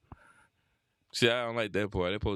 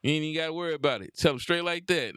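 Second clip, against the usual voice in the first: ordinary delivery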